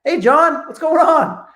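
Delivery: high, bright, punchy hello; upbeat greeting. Shown as a demonstration. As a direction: hit them with energy straight away. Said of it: "hitting them straight between the eyes".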